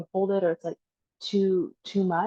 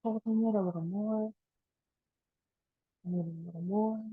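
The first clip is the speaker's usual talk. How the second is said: easing softer as each breath is let go; guided breathing meditation; 4 dB quieter than usual. Acted. Teacher counting down the last breaths, guided meditation.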